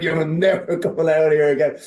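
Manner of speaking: mock fury. Guest retelling blaming his friend at the match, mock furious. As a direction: pretend to banish the friend for good, half laughing. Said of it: "I was furious with him".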